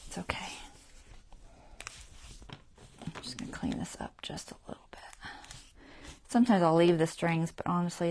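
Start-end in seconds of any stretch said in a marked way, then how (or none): 0.1-5.0 hushed, hurried whisper. Real, not acted. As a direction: whisper it fast under your breath. Trimming threads quietly.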